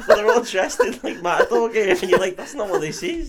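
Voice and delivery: high, loud, still laughing; creased with laughter. Real, not acted. Man describes matador gear to friend, laughing. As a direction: keep telling it as the laughter bubbles up. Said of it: "I was fucking creased off it".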